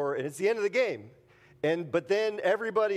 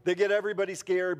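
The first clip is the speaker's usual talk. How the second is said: ordinary talk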